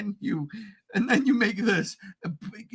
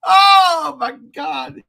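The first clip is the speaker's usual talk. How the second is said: excited; 6 semitones higher, 5 dB louder than usual. Real, not acted. Excited memory of bootleg Godzilla.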